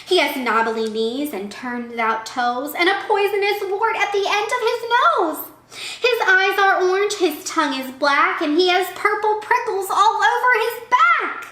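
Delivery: high, loud sing-song storytelling; animated story reading. Acted. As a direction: build the description up for young listeners. Animated picture-book reading.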